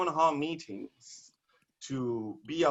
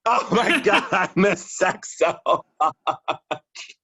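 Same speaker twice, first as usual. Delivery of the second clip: laughing; 5 semitones higher, 6 dB louder, slower than usual. Real, not acted. Poet cracking up at his own joke, laughing. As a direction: laugh through the words, high and loud. Laughing after shouted punchline.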